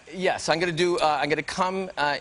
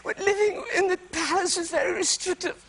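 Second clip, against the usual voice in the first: pitched way up, mock-royal lament; high posh princess imitation; 12 semitones higher than usual. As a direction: play the tearful princess, high and fluttery. Princess Margaret quoted, high voice.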